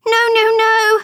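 very high, loud cry of protest. A narrator's reading. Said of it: "Amelia cried".

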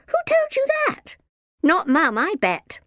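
put-on very high-pitched protesting girl; indignant child. A narrator's reading. Izzy disputes what the minder heard, indignant.

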